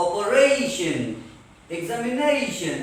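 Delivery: OPERATION and EXAMINATION with "RAY" and "NAY" stretched; stress before -tion. Shown as a demonstration. Teacher showing the accent mark, stretched "RAY" and "NAY". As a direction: lean on the vowel before "-tion". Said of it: "how do we show the accent mark".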